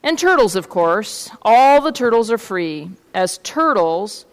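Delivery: measured picture-book reading; story narration. A narrator's reading. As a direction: read the tale aloud to children. Reads a story aloud.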